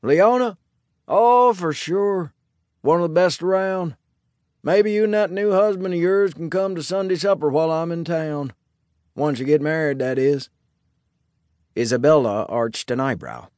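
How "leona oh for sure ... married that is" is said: friendly sheriff. A narrator's reading. Sheriff invites the bride-to-be to Sunday supper, friendly. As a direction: play an easygoing lawman, pitched well up.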